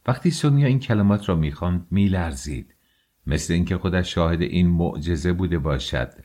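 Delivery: usual speaking voice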